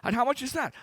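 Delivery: surprised. Retold or imitated.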